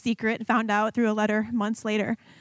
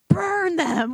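high, drawn-out mock curse; vengeful prayer; 8 semitones higher, 4 dB louder than usual. Praying awful things on her mom's husband, drawn-out vengeful cry. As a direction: lean long and hard on the curse. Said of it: "I really was praying, like, awful things".

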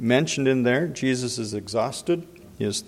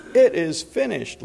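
IT IS FINISHED cried out with lots of emphasis, raised pitch; emphatic cry; 6 semitones higher, 4 dB louder than usual. Retold or imitated. Preacher suggesting how Jesus may have cried it, emphatic. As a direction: stress "It is finished" hard, pitched up high. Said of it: "like, lots of emphasis".

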